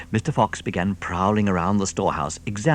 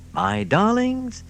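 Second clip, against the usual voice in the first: tender; 13 semitones higher, slower than usual. Acted. To his children.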